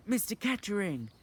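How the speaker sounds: high shouted name; girl shouts the name as memory returns. Acted. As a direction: call the name out loud. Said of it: "she shouted out".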